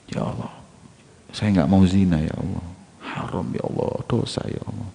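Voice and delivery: very soft, murmured prayer; small, timid role-play voice; hushed acted plea. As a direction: plead quietly to God. Young man prays against sin.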